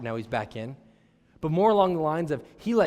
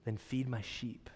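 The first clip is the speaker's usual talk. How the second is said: slow, softer tender charge; gentle Jesus; 3 semitones lower, 10 dB quieter, slower than usual. Retold or imitated. Pastor voices Jesus, soft and slow, to Peter. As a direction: lay it on him gently. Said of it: "And Jesus said".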